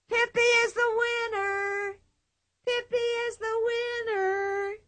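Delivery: high crowd chant; crowd crying out. Acted. The crowd proclaiming Pippi the winner, chanting. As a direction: chant it like the whole tent. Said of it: "cried all the people".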